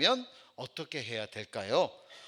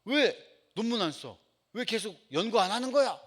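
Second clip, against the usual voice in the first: imitating a professor's outburst, higher pitched; angry scolding; 5 semitones higher, 4 dB louder than usual. Professor demanding papers from former student, retold angry scolding. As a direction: scold in raised-pitch bursts, mock cross. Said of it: "저한테 버럭 화를 내는".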